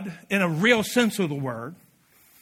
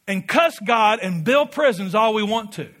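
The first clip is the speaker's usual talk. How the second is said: usual speaking voice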